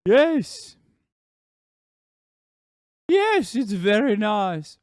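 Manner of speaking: high, loud, drawn-out English voice; Widdicombe. Acted. Streamer switching to Widdicombe accent, high and loud. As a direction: stretch it out in a high mock-British accent. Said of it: "And Widdicombe accent now".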